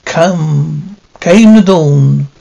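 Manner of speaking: loud, slow ghoulish host; cackling horror host cadence; vintage horror narrator. Acted. Crypt Keeper intro.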